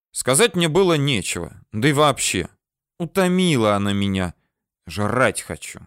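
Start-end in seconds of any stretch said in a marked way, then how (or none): none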